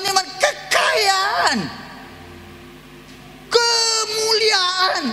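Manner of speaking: crying out the blessings in rising fervour; fiery preaching. Acted. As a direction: preach it out to the congregation. Preacher at full force.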